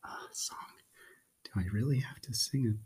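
quick worried whisper; a reluctant reader's panicked aside. Acted. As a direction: hiss it fast under your breath, dreading the song. Dreading having to sing.

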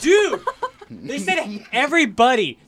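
high, laughing outburst, drawn-out words; loud indignant protest. Real, not acted. Arguing the song clip was too easy, loud laughing protest. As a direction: protest loudly in laughing disbelief.

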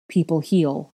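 usual speaking voice

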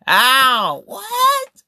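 squeaked, very high what; shocked what. Real, not acted. Host reacting to the cheating, in a very high squeak.

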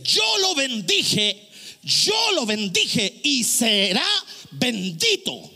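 slow, weighty preaching; deliberate pulpit proclamation; emphatic declamation. Acted. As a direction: draw out each word as a solemn declaration. Declaims the verse for effect.

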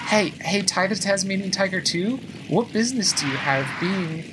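high-pitched mock scolding; mock indignant. Player scolding the game for its surprise racer, mock indignant. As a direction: call it out in playful indignation.

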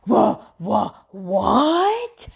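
stammered, rising what; retold stammering shock; shocked disbelief. Retold shock at news of guns.

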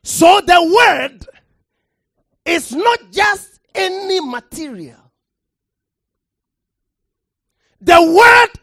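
loud, rising pulpit delivery, hammering the word; preacher thundering from the pulpit; full-force preaching. Acted. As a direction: preach it hard to the congregation.

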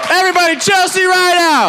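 loud, high hype call; announcer shout. Real, not acted. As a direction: shout the name to the crowd. Host announces roaster.

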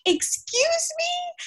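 high, slow, indignant excuse me; offended excuse me. Retold or imitated. Offended commuter answers the rude man, slow and high. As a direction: stretch it out in shocked disbelief.

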